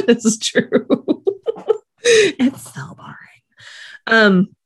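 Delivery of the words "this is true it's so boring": talking through giggles; breaking up with high laughter; laughing. Real, not acted. Cracking up at joke.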